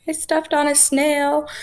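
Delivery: high, teary wail of a confession; tearful confession. Retold or imitated. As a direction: blurt it out, on the verge of sobbing. Confessing to roommates while crying.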